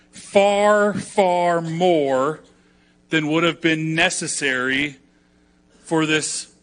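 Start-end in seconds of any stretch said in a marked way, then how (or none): 0.1-2.4 «Far, far more» — громко, высоко, с силой на far; stress on far. Real, not acted. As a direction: hit «Far» hard and high, then ease on far more. Preacher stressing the amount.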